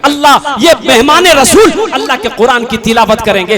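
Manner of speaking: loud fervent appeal for the madrasa; preaching appeal. Acted. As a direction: rouse the crowd to give. Loud fundraising sermon. (Josheela Madrasa Chanda appeal)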